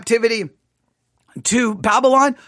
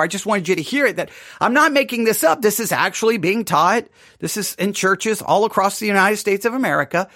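normal talking voice

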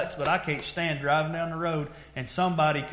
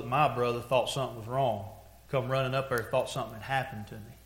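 normal talking voice

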